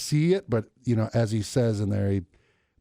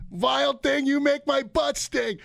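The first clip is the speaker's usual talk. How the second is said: high, slow goofy heckler voice; sing-song taunting heckle; Major League Two fan; 14 semitones higher, slower than usual. Retold or imitated. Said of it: "I'm almost like the dude from Major League Two".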